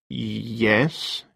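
uncertain. Acted. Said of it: "he agreed uncertainly".

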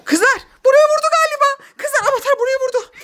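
high, loud startled call; excited alarm. Acted. Mother alerting girls to a bang, excited high shout. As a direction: cry out the news, thrilled. Mother reacts to noise in game.

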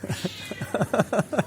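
a hushed, high chuckle; quiet giggle at the kitten; soft high laugh. Real, not acted.

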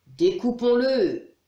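growled storybook demon order; growling demon. A narrator's reading. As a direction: drag it out like a greedy demon. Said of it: "grogna le premier".

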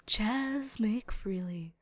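slow, soft whispered name; defeated whisper. Real, not acted. Disappointed reveal, whispered.

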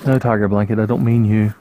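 ordinary talk, nothing marked

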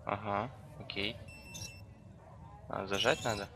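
normal talking voice

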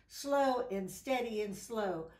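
ordinary delivery, nothing marked

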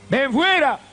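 high, called out command; shouted command. Acted. Jesus calls Lazarus out of the tomb, high mock shout. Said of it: "le gritó dos palabras".

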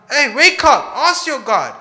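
loud, high-pitched shout; shouted wake-up. Acted. Captain goes down to sleeping Jonah, shouted wake-up. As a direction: shout to jolt a sleeper awake.

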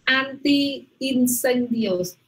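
"Anti-incendios" drawn out slowly, the i held long; teacher sounds out each syllable of "Anti-incendios"; slow model pronunciation. Shown as a demonstration. Teacher models the word slowly for a student. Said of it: "Otra vez, Queen".

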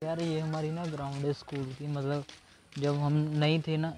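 ordinary delivery

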